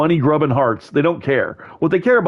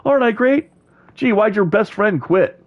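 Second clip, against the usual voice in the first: mock grease man; 7 semitones higher than usual. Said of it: "the guy who goes".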